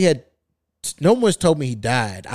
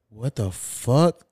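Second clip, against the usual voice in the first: slow, softer, lower question; bewildered disbelief; 4 semitones lower, 5 dB quieter, much slower than usual. Retold or imitated. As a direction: draw it out low in quiet disbelief. Bewildered at drunk uncle at peephole.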